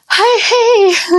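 high, loud, strained casual greeting; trying to be cool. Retold or imitated. Greeting the arriving midwife, high strained casual hi. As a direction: force a cool hello through labor. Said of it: "kinda trying to be cool".

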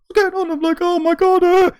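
very high mock panic; imitated scared wife. Wife lying in bed after the shout, imitated panic. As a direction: falsetto fretting, as if frightened. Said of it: "she was really scared".

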